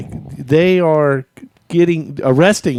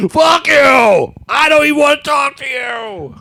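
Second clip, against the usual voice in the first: put-on much higher, loud drunken shout; drunk yelling outside; 13 semitones higher, 4 dB louder, slower than usual. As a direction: scream it like an angry drunk. Acting out drunk friend yelling at Sean.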